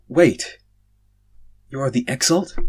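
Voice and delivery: startled. Acted. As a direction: blurt it out in alarm.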